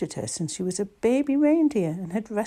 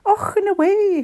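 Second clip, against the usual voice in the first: high exclamation, groan into loud cry; dismissive high-pitched cry; 12 semitones higher, 6 dB louder, much slower than usual. A narrator's reading. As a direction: cry out against a blast of cold. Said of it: "she cried".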